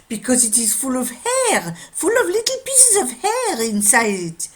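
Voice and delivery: put-on high, loud, excitable French accent. Watchmaker explaining the hair in the watch, French accent. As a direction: pile on the proof in a French accent. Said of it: "a French watchmaker".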